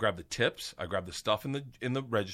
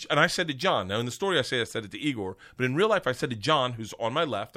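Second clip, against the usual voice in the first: normal talking voice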